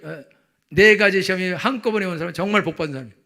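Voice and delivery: fervent pulpit delivery; forceful preaching. Real, not acted. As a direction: preach it out to the congregation.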